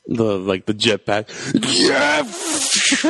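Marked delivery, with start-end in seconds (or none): 1.5-3.1 high triumphant yell; excited shout. Retold or imitated. Quoting Schwarzenegger yell.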